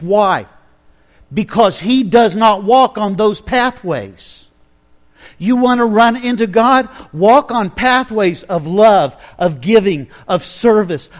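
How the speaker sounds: loud, rising sermon build; full-force preaching. Acted. Pastor urging the congregation toward pathways of love, preaching loud. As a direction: preach it out to the congregation, slow and strong.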